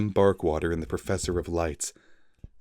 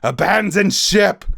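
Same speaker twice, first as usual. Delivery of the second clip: much higher, much louder shout; bellowed; 13 semitones higher, 8 dB louder than usual. Acted. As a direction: bellow it at the crew. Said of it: "he bellowed".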